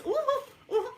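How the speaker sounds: very high-pitched "oh, oh"; startled squeal. Retold or imitated. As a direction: yelp as if suddenly squeezed. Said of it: "I even made this noise".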